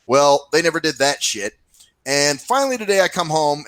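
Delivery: normal talking voice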